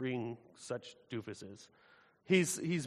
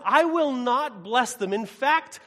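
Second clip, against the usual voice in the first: much higher and louder, slow, outraged divine voice; angry God; 10 semitones higher, 10 dB louder, slower than usual. Acted. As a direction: thunder it out as a wounded, furious God. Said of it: "He says".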